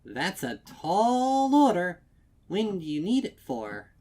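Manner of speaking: high, loud, strained brightness; sugary pony voice, a bit overwhelmed; chipper shopkeeper. A narrator's reading. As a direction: keep smiling through the worry. Shopkeeper reacting to big order.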